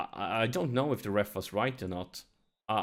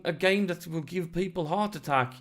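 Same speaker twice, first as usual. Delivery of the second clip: ordinary talk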